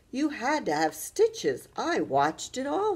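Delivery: put-on slow, high, eager boy's brag; excited. A narrator's reading. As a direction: relish telling her about the stitches. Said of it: "he said excitedly".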